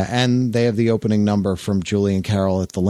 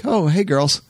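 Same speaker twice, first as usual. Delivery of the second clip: put-on breezy higher hey girls; casual greeting; 8 semitones higher than usual. The guy strolling past the kissing women, breezy. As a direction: toss it off like nothing happened.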